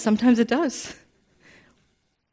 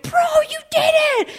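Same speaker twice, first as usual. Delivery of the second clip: very high-pitched, acted-out celebration; excited congratulation. Parents congratulating son on winning, high excited cheer. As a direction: squeal it like an overjoyed parent. Retold congratulation after son's win.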